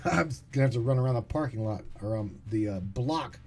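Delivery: fast jittery caffeine-wired talk; rushing, over-caffeinated and wired. Real, not acted. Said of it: "I'm so wired".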